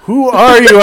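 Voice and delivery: loud, high-pitched demanding call; imitated booming call. Creature interrupting a player mid-chase, loud and demanding. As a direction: boom it out as the intruding creature.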